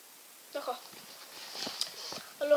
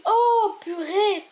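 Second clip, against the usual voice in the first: mock shocked cry; 5 semitones higher, 5 dB louder, much slower than usual. Purée sees the other crushed.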